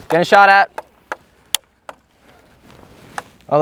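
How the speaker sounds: loud callouts mid-fight; shouting over gunfire. Real, not acted. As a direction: yell it to teammates. Airsoft match callouts.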